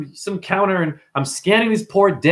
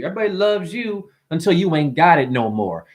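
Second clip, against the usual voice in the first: ordinary talk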